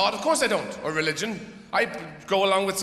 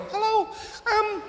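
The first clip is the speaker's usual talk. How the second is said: much higher, slow, starry-eyed lilt; Brian Cox impression; slower than usual. Comic mocking Brian Cox hosting his show: high dreamy voice. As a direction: play the dreamy TV scientist. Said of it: "he would come on and go".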